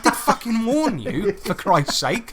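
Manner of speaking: high-pitched, mock-indignant comeback; retold exasperated retort. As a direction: protest to your wife, dragging out the ending. Acted quarrel with wife.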